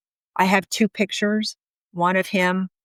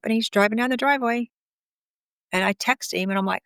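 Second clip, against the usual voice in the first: usual speaking voice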